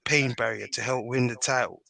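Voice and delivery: usual speaking voice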